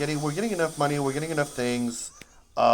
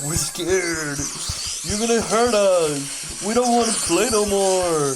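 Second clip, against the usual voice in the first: high, loud mock-frightened plea; scared enemies; 7 semitones higher, 5 dB louder, slower than usual. Zombies pleading with the player, high mock-scared whine. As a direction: whine like beaten enemies begging. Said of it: "they're like".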